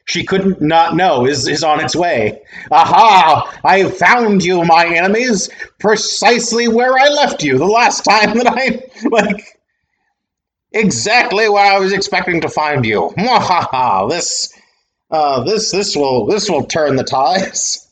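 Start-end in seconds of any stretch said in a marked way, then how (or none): 2.7-13.1 gloating villain with an evil laugh; sneering panto villain. Acted. Voicing villain Cognitum gloating. 13.2-14.1 evil laugh. Acted. Villain's spoken evil laugh. 14.1-17.9 gloating villain with an evil laugh; sneering panto villain. Acted. Voicing villain Cognitum gloating.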